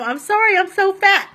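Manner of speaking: high, tearful sorry, lightly mocked; imitated weepy apology. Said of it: "I'm crying, telling them".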